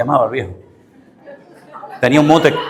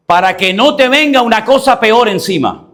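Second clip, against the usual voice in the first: slow warning in a raised, high voice; emphatic warning; 8 semitones higher, 4 dB louder than usual. Acted. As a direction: preach it forcefully, drawing out the words. Preacher voices the Lord's warning. (advertencia enfática, aguda y lenta)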